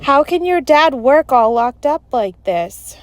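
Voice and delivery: mildly acted dismay at being locked in; shivery unease. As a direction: ask it with a hint of a shudder. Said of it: "Melody said with a shiver".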